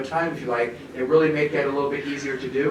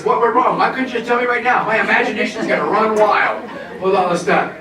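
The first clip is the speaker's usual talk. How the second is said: anxious thoughts racing, louder; nervous inner panic; 4 semitones higher, 6 dB louder than usual. Retold or imitated. Teacher acting out an observed colleague's fears, loud nervous panic. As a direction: voice a teacher spiralling with worry. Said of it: "What is she feeling? Scared, nervous".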